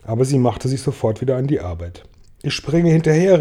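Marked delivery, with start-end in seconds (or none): 2.4-3.4 higher, understated call. A narrator's reading. James announces he will jump after Centipede, mildly called out. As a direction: read it as a quick, resolute call. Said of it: "rief James".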